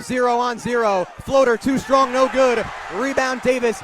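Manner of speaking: ordinary delivery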